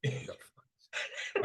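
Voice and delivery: normal talking voice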